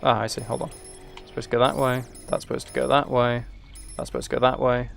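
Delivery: normal talking voice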